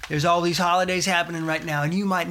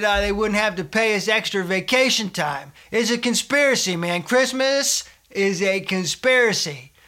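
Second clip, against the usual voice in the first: ordinary delivery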